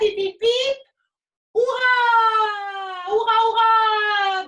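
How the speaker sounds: loud high hurrah cheer; cheering shout. Real, not acted. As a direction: lead the crowd in a cheer. Host leads cheer for Charlotte.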